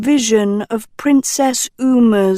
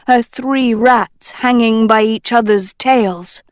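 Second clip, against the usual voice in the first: plain reading voice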